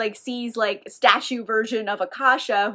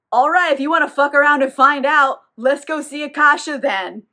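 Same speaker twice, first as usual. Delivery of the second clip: louder, altered sly voice; daring menace; 3 semitones higher, 6 dB louder than usual. Retold or imitated. Marius daring the vampire to come along, menacing. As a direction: taunt him to try it.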